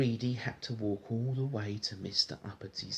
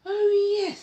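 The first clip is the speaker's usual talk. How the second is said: high, loud haughty exclamation; Mister Uppity remembering; 9 dB louder than usual. Acted. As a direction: toss it off as a pompous rich man. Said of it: "said Mister Uppity".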